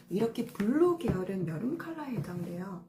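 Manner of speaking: ordinary talk, nothing marked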